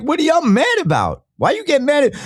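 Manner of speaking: high, baffled disbelief; incredulous. Retold or imitated. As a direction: throw it back at them in disbelief. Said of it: "I'm like".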